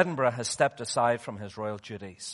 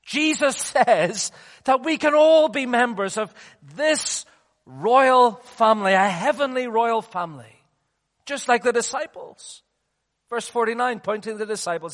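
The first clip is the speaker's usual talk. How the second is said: impassioned preaching; 8 semitones higher, 6 dB louder than usual. Real, not acted. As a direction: proclaim it from the pulpit with force. Preaches at full force.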